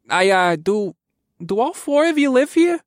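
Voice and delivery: high, flustered, halting question; stammering. A narrator's reading. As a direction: stumble nervously into the question. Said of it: "Taylor stammered".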